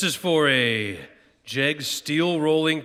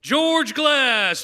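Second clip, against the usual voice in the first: raised high calling voice; calling out name; 11 semitones higher, 3 dB louder than usual. Real, not acted. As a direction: call the name across the room.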